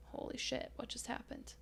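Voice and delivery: stunned whisper. Retold or imitated. Alone after the robbery, whispering in shock. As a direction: barely breathe it out in shock.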